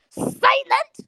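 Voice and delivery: very high, incredulous outburst; outraged, high-pitched questioning. Real, not acted. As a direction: shoot the pitch right up in disbelief. Incredulous question to silent students.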